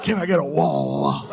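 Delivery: dad hit by the Holy Spirit, mock gospel; mock gospel fervor. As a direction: testify like at a revival. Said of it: "The Holy Ghost visits him".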